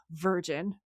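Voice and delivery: low, sighed mock dismissal; sighing judgement. Sam judging Gary's Darth Vader t-shirt, sighing dismissal. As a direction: say it on the tail of a sigh. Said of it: "and he sighs".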